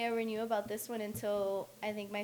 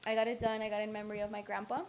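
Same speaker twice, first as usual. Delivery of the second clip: ordinary delivery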